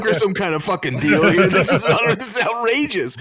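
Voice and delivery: high, loud and fast mock indignation; mock outrage. Real, not acted. Host demanding Robert get Pharaoh his crown, high mock outrage. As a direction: demand it like a fired-up negotiator.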